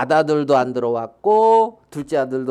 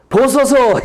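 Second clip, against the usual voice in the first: high, louder indignant protest; raised-voice indignant appeal; angry son; 9 semitones higher, 4 dB louder, slower than usual. Acted. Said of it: "막 이제 화가 나가지고 노해서".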